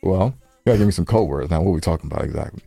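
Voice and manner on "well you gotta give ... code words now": ordinary talk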